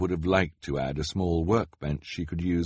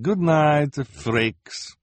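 Madame Lulu voice; 8 semitones higher, 5 dB louder, much slower than usual. A narrator's reading. Lulu leaves.

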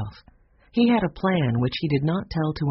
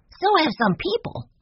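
put-on much higher, bright little boy voice; young Benny; 14 semitones higher than usual. A narrator's reading. Benny explaining why the bird won't talk, high boyish voice. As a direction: pipe up like a small boy.

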